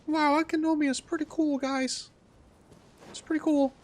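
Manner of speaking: lightly put-on high gushing voice; high squeaky voice. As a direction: gush in a squeaky cartoon voice.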